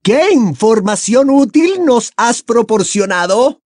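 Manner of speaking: loud, high-pitched scornful demand; high, haughty female villain; Bellatrix, disdainful. A narrator's reading. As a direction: demand an answer with contempt. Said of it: "repuso Bellatrix con desdén".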